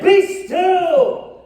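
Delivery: loud, high Be still; commanding shout. Acted. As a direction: order the monsters to freeze. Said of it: "So Max said".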